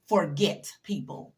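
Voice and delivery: Forget drawn out slowly, hit hard; slow emphatic dismissal; slow pointed. Real, not acted. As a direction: say it slowly, like a motto. First slow take of the phrase.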